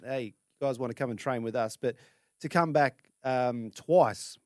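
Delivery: ordinary talk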